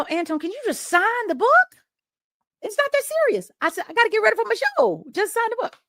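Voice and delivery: high-pitched exasperated complaint; exasperated protest. Retold or imitated. Retold quarrel over signing book.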